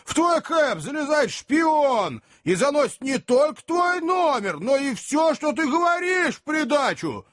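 high-pitched shouted grievance; outraged appeal. A narrator's reading. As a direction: work up the crowd with your outrage. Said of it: "апеллировал возница к толпе". (возмущённый крик на всю толпу)

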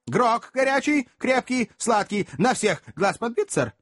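high-pitched string of brief orders; calling out orders. Acted. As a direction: fire off the order item by item. Stranger barks orders to waiter. (отрывистый заказ высоким голосом)

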